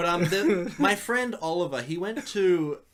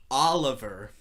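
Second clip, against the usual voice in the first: "Oliver" in an exaggerated American accent; American pronunciation of Oliver; 3 semitones lower, 3 dB louder, slower than usual. Friend ordering in America so he is understood, "Oliver" in American accent. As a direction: say "Oliver" American-style, with an open "ah" and hard final R. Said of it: "didn't understand him until he said".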